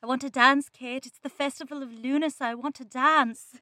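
theatrical Irish lilt; Irish accent. Shown as a demonstration. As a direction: play a yearning line in an Irish accent. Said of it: "sometimes do an Irish accent".

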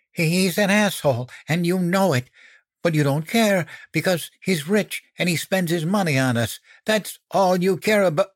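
high, agitated outburst; tearful accusation. A narrator's reading. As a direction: press on accusingly, holding back tears. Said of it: "I swallowed back my tears and pressed on".

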